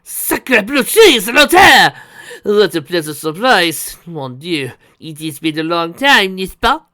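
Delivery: put-on high, loud, excitable French accent; heavy French accent. A narrator's reading. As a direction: gasp and gush like a theatrical Frenchman. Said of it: "in his heavy French accent".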